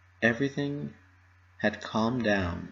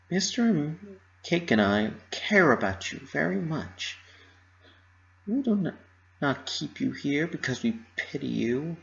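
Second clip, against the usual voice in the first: raised motherly voice, comforting; a higher mare's voice reassuring her friend; Mrs Cake voice; 6 semitones higher than usual. Acted.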